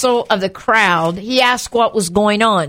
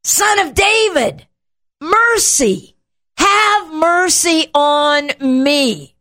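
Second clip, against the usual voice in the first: high, drawn-out plea for mercy; imitating a yelled appeal; acted yell; 5 semitones higher, slower than usual. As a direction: cry out like a desperate beggar, pitched up. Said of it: "that the blind man yells".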